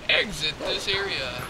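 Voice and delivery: normal talking voice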